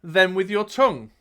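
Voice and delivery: dramatic storyteller, key words punched; game master narrating the action; gamebook narration. A narrator's reading. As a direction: read it out like an adventure tale. Reads gamebook aloud to player.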